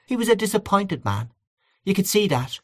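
a hint of a sympathetic aunt; aunt agreeing.

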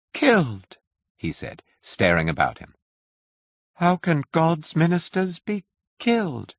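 high, shocked question; aghast disbelief. A narrator's reading. Curate shocked that a Martian died, high and aghast. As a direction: recoil in disbelief.

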